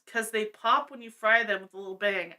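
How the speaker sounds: normal talking voice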